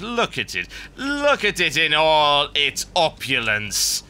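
theatrical villain, drawn-out and rising to a shout; gloating villain announcer. Acted. Villain presenting a hated city to an audience, gloating grandly. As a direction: grandiose evil monologue, building in fury.

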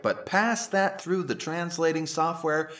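usual speaking voice

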